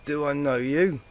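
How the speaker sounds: tipsy mare voice. A narrator's reading. Drunk mare asks.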